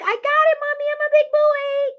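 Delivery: put-on high, loud little-kid voice; little boy. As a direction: pipe up like an eager small boy. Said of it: "the little boy was like".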